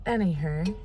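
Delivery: ordinary delivery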